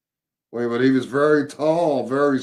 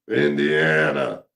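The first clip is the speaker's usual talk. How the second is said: low, slow and a bit louder Indiana; lower, eerie voice, stretching the name out; insisting on the name, deeper and slower; a little louder; 9 semitones lower, slower than usual. Retold or imitated. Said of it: "he said a little louder".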